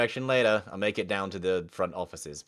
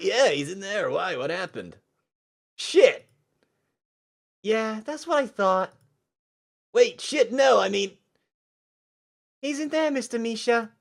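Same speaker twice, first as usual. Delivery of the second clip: put-on higher, louder, panicky teen; high flustered voice; 12 semitones higher, 8 dB louder than usual. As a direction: blurt it out flustered. Voicing Leon, high loud.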